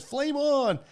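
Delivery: high-pitched heroic call, imitated; Johnny Storm catchphrase. Podcast host quotes the superhero's entrance, imitated battle cry.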